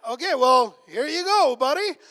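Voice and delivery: high, loud, give-up "fine then"; exasperated radio DJ giving in; loud resigned DJ. Retold or imitated. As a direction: throw up your hands and hand it over, loud. DJ caves to kid's request.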